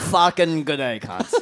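broad Australian bogan, trailing low; [name]. Pretend. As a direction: crude Aussie greeting in put-on accent. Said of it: "the bogan".

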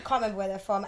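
ordinary talk, nothing marked

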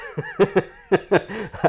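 loud high burst of real laughter. Real, not acted. Artist laughing at his teenage cluelessness, loud laugh. Recalling his naive younger self.

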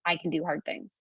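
soft, calm and steady; a soft, sure murmur; quiet voice. Shown as a demonstration. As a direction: say it gently, like a quiet inner voice. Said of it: "a different voice who's just quieter".

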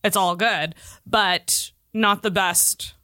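usual speaking voice

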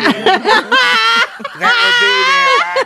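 mock British lass. Mock British lass answering a stranger in the street, hammed-up and laughing. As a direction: play a cheeky British lass agreeing, laughing. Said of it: "and she's like".

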